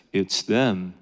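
mock divine voice, deep and distant; booming voice from heaven. Preacher mimics God's voice from the heavens, low and booming. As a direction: drop way down low for the voice from the sky. Voice of God from the sky.

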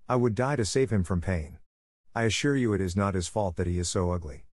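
ordinary delivery

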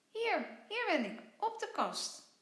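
high, bright-eyed tiny creature voice; playful little creature calling; little creature Vlos. Acted. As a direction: squeak it out like a small cheeky critter.

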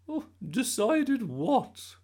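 high, loud, slow granny; innocent Gran voice. A narrator's reading. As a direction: play dumb sweetly.

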